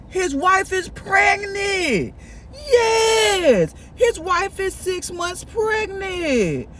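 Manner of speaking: high, drawn-out dramatic disbelief; scandalized reveal. Acted. Storyteller tops off friend's scandal, scandalized and drawn out. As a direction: stretch the shocking news out, pitched high.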